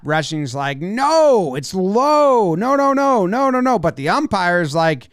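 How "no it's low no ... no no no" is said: insistent no. Retold or imitated. Catcher insists pitch was low.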